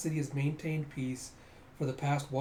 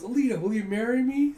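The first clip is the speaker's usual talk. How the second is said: raised, louder mock proposal; hammed-up proposal; 9 semitones higher, 8 dB louder than usual. The man proposing to Alita, mock earnest. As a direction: pop the question with ham.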